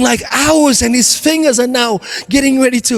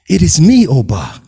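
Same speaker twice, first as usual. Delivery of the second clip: much lower, solemn divine voice; voice of God; 9 semitones lower than usual. Acted. God calling down to Oba, much lower and solemn. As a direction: speak down from the heavens, calm and low. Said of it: "And the voice says".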